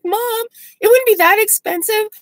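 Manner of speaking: high-pitched imitation of a pleading child; pleading kid. Imitated child to mom about boarding school, pleading.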